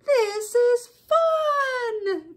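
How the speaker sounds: high, very drawn-out cheer; gleeful animals. A narrator's reading. All the animals riding round the barnyard, gleeful cheer. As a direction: stretch each word in a high, joyful cry. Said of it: "they all said".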